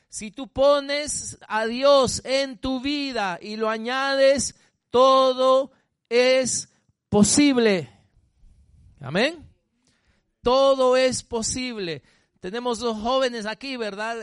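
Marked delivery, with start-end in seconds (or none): 0.1-12.0 loud, slow, drawn-out preaching; full-force preaching. Acted. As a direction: preach it out, hammering each word. Preacher repeats climax. (predicación a todo pulmón, lenta y fuerte)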